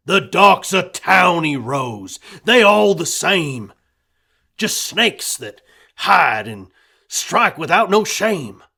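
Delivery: loud, bitter spitting of the words; bitter rant. A narrator's reading. Husband ranting to wife about townies, loud and bitter. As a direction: warn her the townies are snakes.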